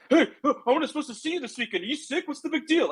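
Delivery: mocking a panicked friend; mocking alarmed. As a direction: parody his worried fuss. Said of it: "doesn't have to be a".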